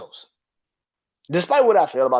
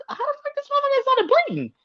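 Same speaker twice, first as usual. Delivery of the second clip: baffled high squeak; faster than usual. Retold or imitated. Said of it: "I'm like".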